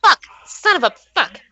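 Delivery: sudden pained curse; stubbed toe. Real, not acted. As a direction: yelp of pain from a stubbed toe. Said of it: "Okay, sorry, stub my toe".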